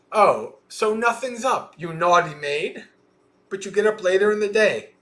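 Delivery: high-pitched mock indignation; angry scolding. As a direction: play a cross mistress telling off her maid. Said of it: "Angry and".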